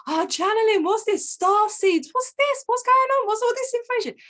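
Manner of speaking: high-pitched giddy questions; excited. Retold or imitated. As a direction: gush like a kid finding treasure. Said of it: "I'm getting excited".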